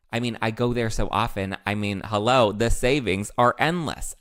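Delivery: ad read. Acted. Reads sponsored ad.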